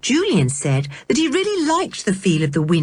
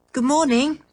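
put-on much higher, polite children's greeting; polite chorus; 9 semitones higher than usual. A narrator's reading. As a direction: chime it politely as children together. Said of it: "chorused the children politely".

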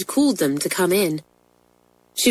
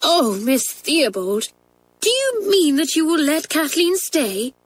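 put-on high, hopeful girlish cry; eager cry; 9 semitones higher than usual. Pat crying out to the head in hope, eager girl voice. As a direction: burst out with hope. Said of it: "cried Pat".

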